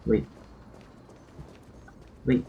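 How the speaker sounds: ordinary talk, nothing marked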